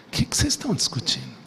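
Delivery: low, soft and quick, with a knowing irony; a quiet, ironic question from Jesus. Retold or imitated. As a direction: ask it as if you already know the answer. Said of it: "uma certa, entre aspas, ironia".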